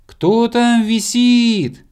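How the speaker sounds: high, slow, loud hail; calling from below. A narrator's reading. Friend on the ground to hanging stranger, high drawn-out shout. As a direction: holler a question up into the sky. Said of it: "услышал он знакомый голос с земли".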